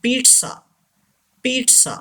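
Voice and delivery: "पिज़्ज़ा" said as peet-sa; model pronunciation. Shown as a demonstration. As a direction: say "पिज़्ज़ा" with a ts in the middle. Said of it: "Say this word". ("पिज़्ज़ा" को "पीट्सा" बोलें)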